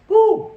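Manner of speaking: short, loud high woo; one sharp celebratory whoop; whoop of relief. Retold or imitated. Drunk tourist accepts the excuse, relieved whoop. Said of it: "instant just relief".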